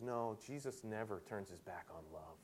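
low, very soft mock-pastoral comfort; gently reassure, in a hushed low voice; soothing pastor. Preacher voicing a pastor consoling a weeping woman, soft and soothing.

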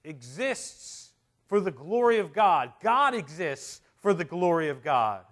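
loud, slow, weighty proclamation; full-force preaching. Real, not acted. Preacher proclaiming God's glory to the congregation, loud and emphatic. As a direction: preach it out, punching each phrase.

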